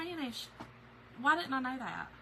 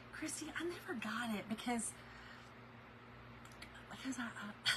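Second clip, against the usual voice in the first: sheepish whisper; 10 dB quieter than usual. Real, not acted. Sheepish admission to viewer.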